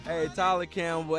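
ordinary delivery

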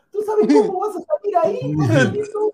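amused indignant raise; incredulous outburst. Real, not acted. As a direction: warn him how he'd come out. Heated argument, louder, higher.

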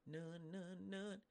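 near whisper, dropping away; soft emphatic none. Real, not acted. Preacher telling a heckler it's none of his business, hushed.

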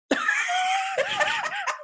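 shrieking laugh, very high. Real, not acted. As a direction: burst into a screaming laugh. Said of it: "Your face".

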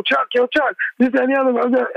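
high, excited calling. Retold or imitated. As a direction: toss off the hype-man intro up high. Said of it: "the [name]'s, [name]'s rant".

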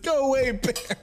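high and laughing through it; retold bear warning, laughing; laughing mock shout. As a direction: shout it while cracking up. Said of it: "and I said".

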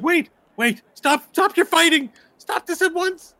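very high, loud protest; frantic elf. Acted. Elf tries to stop fighting, high and urgent. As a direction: cry out to halt a brawl. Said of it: "the elf is going".

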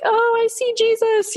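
high, mock child voice, excited; lightly playing a preschooler spotting the sun; mother imitating her little girl in the car, high and excited; imitating little daughter. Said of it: "she's like".

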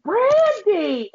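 high, drawn-out exclamation; excited call-out. Retold or imitated. Quotes friend's excited message calling out.

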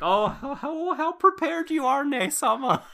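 swooning sister. Retold or imitated. Sisters praising their leader, high giggly adoring squeal. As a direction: gush with admiration through laughter. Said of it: "And they're like".

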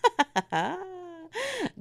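high, cracking burst of laughter; high cackling laugh. Real, not acted. Laughing after admitting she lied, high cackle. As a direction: cackle at her own fib.

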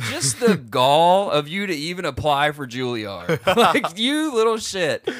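hammed-up, high indignant rant; mock outrage. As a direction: scold him in comic disbelief. Mock rant at the applicant's nerve.

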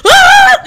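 a short, very high, loud mock scream; imitating passengers' scream. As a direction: let out a piercing mock shriek. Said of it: "Everyone was like".

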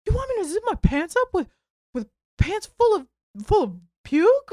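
whiny guy. Retold or imitated. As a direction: squeak it out in disbelief. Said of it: "He goes, ... Like this".

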